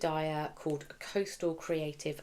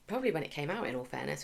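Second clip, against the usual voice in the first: normal talking voice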